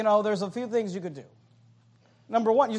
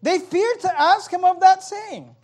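higher, louder, slowed retelling; preacher raising voice on scripture; loud emphatic reread; 10 semitones higher, 9 dB louder, slower than usual. Shown as a demonstration. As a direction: hammer home the disciples' fear. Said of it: "They didn't wanna ask".

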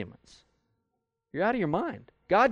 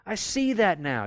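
sudden realization; 7 semitones higher, slower than usual. Retold or imitated. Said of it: "you go".

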